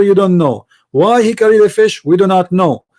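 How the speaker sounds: normal talking voice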